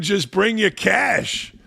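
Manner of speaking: ordinary talk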